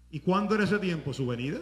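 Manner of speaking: ordinary talk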